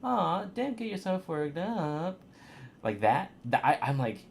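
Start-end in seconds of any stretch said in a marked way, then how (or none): 0.0-2.2 higher and slow, gently reassuring; normal caring March. Retold or imitated. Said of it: "she would've said".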